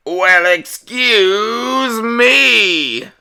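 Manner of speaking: high, very slow comic drawl; stand-up comic catchphrase. Acted. The TV doing stand-up at the dinner table, high and drawn out. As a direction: stretch it out like a stand-up punchline.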